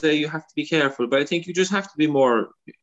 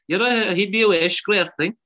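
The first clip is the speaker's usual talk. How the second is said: West Cork man; 8 semitones higher than usual. Retold or imitated. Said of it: "He said".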